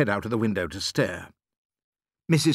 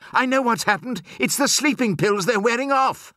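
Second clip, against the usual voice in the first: high-pitched, excited outburst; alarmed realisation; 12 semitones higher than usual. A narrator's reading. Doctor realising why the birds wake, excited alarm. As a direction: blurt out the discovery, voice up high.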